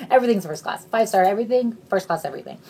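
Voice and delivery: ordinary talk, nothing marked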